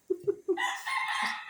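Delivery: sudden loud laughing outburst; loud high laugh. Real, not acted. Teased while eating.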